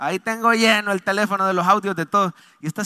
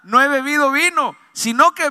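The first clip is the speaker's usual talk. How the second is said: loud, high declaimed plea; raised preacher's retelling of a plea; impassioned protest; 7 semitones higher, 8 dB louder than usual. Retold or imitated. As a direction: insist on your innocence with fervor. Preacher acts out Ana's reply.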